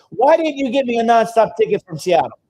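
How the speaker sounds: student complaining about her flight, mock whine; entitled complaint.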